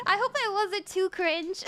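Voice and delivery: ordinary talk, nothing marked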